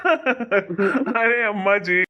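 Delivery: slow, laughing plea. Acted. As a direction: laugh through mother's name. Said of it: "हंस रहा है".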